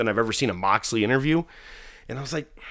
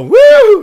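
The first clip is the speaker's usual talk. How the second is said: very high, loud "woo"; crowd cheer; 20 dB louder than usual. Retold or imitated. Crowd answers the hometown shout, high loud whoop. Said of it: "And they go".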